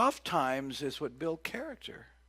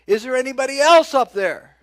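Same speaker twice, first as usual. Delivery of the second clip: loud, high call upward; man on the cliff shouting for someone else; shouted question; 13 semitones higher, 20 dB louder than usual. Pretend. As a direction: holler up at the sky.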